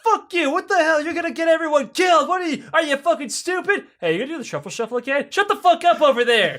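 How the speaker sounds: very high-pitched, hammed-up ranting; acted furious tirade. Kremlin officials tearing into Khrushchev, hammed-up fury. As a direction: rant over him at a high pitch.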